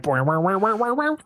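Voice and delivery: rising, sing-song boing noises; mock transform sound effect. Host imitating the hero's own transformation sound, rising boings. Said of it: "he had to make the sound effects too".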